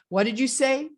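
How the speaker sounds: high, rising, insistent question; disbelieving question. Acted. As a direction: ask it as if you cannot take it in. Acts shocked relative asking again.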